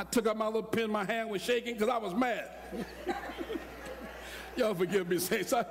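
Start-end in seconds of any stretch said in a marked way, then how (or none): 2.6-4.5 breathy high giggle; a quick airy laugh at himself; soft unvoiced chuckle. Real, not acted. Laughs at own story.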